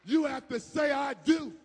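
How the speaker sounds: loud, high, shouted declaration; preaching shout. Real, not acted. Preacher shouting to the congregation that they must say it. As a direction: proclaim it to the whole church.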